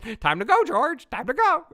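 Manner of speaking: high, loud squawking bird; parakeet voice. Pretend. Playing a bird nagging George, high squawky voice. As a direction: squawk it like a pet parrot. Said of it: "kinda like a parakeet".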